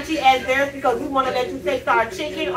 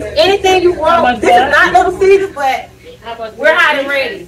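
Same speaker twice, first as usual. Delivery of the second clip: sales pitch; 5 dB louder than usual. Real, not acted. Employee greets vlogger with sales pitch.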